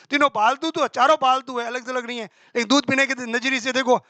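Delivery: ordinary talk, nothing marked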